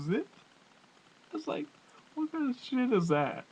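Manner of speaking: ordinary talk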